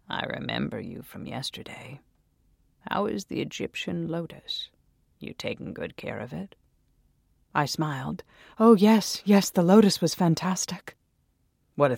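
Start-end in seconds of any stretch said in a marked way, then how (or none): 0.1-6.5 put-on quiet, creepy old shopkeeper voice. 8.6-11.0 louder, higher eager voice. Acted. Narrator voices her eager self.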